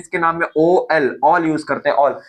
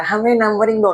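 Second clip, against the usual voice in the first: oxygen as a pushy little character, pitched higher; playful higher-pitched atom demanding to be numbered; 5 semitones higher than usual. Retold or imitated. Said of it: "oxygen आके चिल्लाए".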